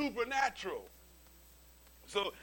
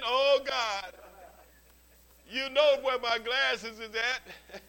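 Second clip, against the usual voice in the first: louder, slow pleading cry to God; mock desperate prayer; 4 semitones higher, 8 dB louder, much slower than usual. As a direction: ham up a desperate plea over lost glasses. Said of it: "you start praying".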